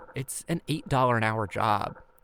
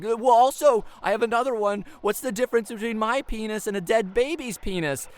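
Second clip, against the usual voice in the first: desperate joke; 10 semitones higher, 5 dB louder than usual. Retold or imitated. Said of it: "And I'm like".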